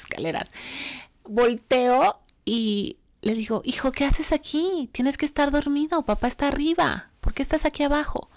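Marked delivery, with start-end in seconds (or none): 3.6-8.3 higher-pitched exasperated scolding; annoyed mom. Retold or imitated. Mom finds son downstairs past bedtime, retold annoyed scolding. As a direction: retell a mother's miffed questioning of her child.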